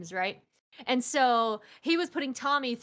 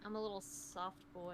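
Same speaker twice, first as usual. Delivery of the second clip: very quiet, low little voice; playfully soft; 4 semitones lower, 17 dB quieter than usual. Pretend. Friend told she is a little soft, playfully quiet. Plays on being called too soft.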